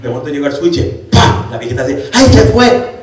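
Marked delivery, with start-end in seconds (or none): 2.1-2.9 high, loud cry with a laugh; high surprised old lady exclaiming. Retold or imitated. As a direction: blurt out amazed that it's gone. Old lady sees shadow vanish.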